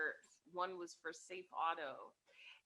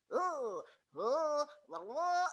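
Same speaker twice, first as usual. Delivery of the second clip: higher, louder cartoon oohs; pitched-up nonsense "oh" and "la la"; voice actor's noises; 6 semitones higher, 7 dB louder, much slower than usual. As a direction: imitate silly mascot sound takes. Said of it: "he's just in the microphone".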